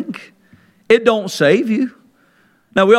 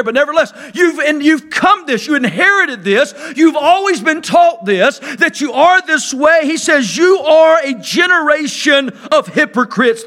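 preaching at full force; 5 semitones higher than usual. Acted. Preacher to his congregation about the Pharisees, preaching at full force. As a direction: thunder it out like a fiery preacher.

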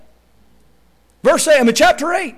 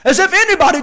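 ordinary talk

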